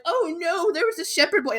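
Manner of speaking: high, hammed-up lament; mock crying. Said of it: "And she starts crying".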